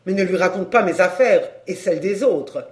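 loud, exasperated outburst; angry. Acted. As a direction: scold your friend, starting loud. Gémozac's angry quarrel with friend.

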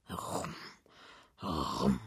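very soft, low rumbled "rum"; imitated cloth-measuring noise. A narrator's reading. Narrator imitating the coupon being measured, low soft rumble. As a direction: murmur the sound of cloth being unrolled. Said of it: "macht der Coupon".

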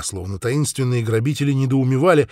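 usual speaking voice

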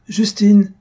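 loud sharp call of a name. A narrator's reading. Jujube calls the maid across the house, loud. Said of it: "cria Jujube à ce moment".

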